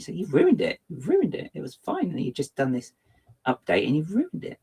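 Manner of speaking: high, rising mock reproach at the program; mock scolding. Streamer scolding the update that broke things, mock indignant. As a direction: tell the software off like a naughty child.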